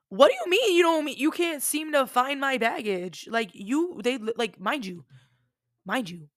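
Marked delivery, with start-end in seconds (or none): 0.1-3.3 disbelief. Retold or imitated. Disbelieving reply to lost bags.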